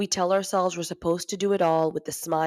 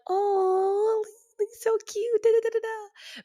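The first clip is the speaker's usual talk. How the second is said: put-on very high, drawn-out baby-cooing voice; cooing meeting crowd; slower than usual. Thirty people on a meeting admiring a baby, cooing. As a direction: gush in a squeaky imitated coo. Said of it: "about thirty people, they were like".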